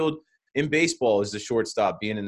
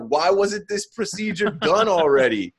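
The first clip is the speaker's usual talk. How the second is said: higher, slow, incredulous whine; exasperated mock question; 8 semitones higher, 4 dB louder than usual. Fans griping about the medical staff, exasperated and incredulous. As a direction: drag it out in disbelief at the staff. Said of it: "we're like".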